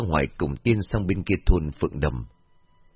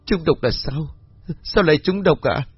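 crying disbelief; 7 semitones higher than usual. A narrator's reading. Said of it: "vừa khóc bà vừa bảo".

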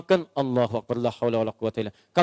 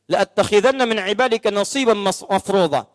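Quran recitation; 3 semitones higher than usual. Acted.

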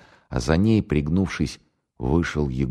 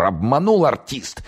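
high, drawn-out dismay; stretched, exasperated moan; groaned; 9 semitones higher, slower than usual. A narrator's reading. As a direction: lament being tricked, slowly. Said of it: "простонал старший майор".

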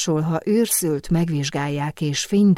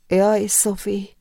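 mildly tearful, slow and higher; understated tearful lament; sniffling; 4 semitones higher, slower than usual. A narrator's reading. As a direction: draw it out with a sniffly sigh. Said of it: "szipogta a nyomdász lánya".

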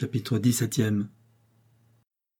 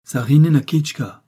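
slow, tense, louder incantation; tendue; 4 semitones higher, 7 dB louder than usual. A narrator's reading. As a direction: let it burst out tensely, drawn out. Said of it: "d'une voix faible mais tendue". (exclamation tendue, lente et appuyée)

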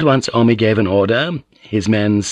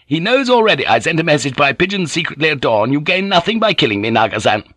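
high-pitched, desperate pleading; blurting; 7 semitones higher, faster than usual. A narrator's reading. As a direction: blurt out your excuse to save your life. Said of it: "Jozen blurted out".

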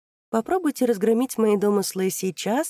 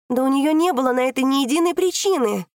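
loud protest; 7 semitones higher, 7 dB louder than usual. Acted. Daughter shouts defending mother.

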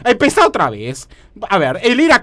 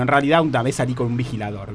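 ordinary talk, nothing marked

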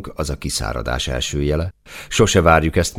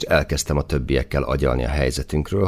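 ordinary delivery

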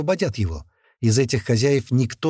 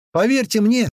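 higher-pitched, insistent address; voiced young gunman; 10 semitones higher, 4 dB louder than usual. A narrator's reading. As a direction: address the onlookers in a raised pitch. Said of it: "сказал этот парень с револьверами".

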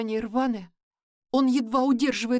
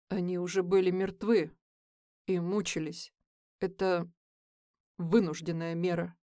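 calm; 5 semitones lower, 3 dB quieter than usual. Acted. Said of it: "Спокойно отзывается Ник".

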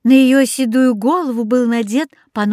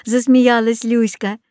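ordinary delivery

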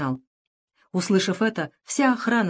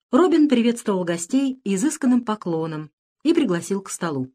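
plain reading voice

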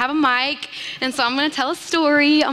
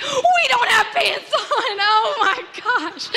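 laughing shriek; 9 semitones higher, slower than usual. Real, not acted. Realizing no pants.

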